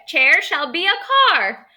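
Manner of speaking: hammed-up fairy magic chant, rising high on biff bang bong; fairy casting a spell. Teacher reads the fairy's spell to the class, high and chanting.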